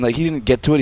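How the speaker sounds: ordinary talk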